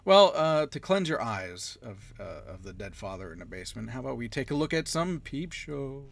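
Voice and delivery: ordinary delivery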